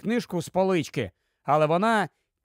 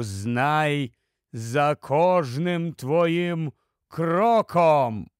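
slow, deep, drawn-out riddle voice; much lower voice; 4 semitones lower, slower than usual. A narrator's reading. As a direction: intone it low like an otherworldly book speaking. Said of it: "значно нижчий за той".

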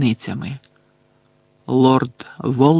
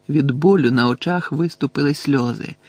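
ordinary delivery

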